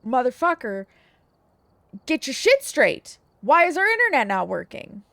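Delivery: imagine chewing out the internet provider, loudly. Pretend. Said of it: "be like".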